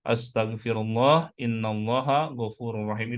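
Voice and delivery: drawn-out, measured Arabic recitation; slow model recitation. Shown as a demonstration. Preacher repeats the istighfar for congregation to learn, slow recitation. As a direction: recite it slowly for others to learn. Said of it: "Saya ulangi".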